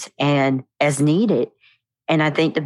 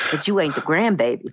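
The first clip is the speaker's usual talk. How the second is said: normal talking voice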